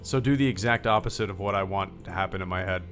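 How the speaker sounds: normal talking voice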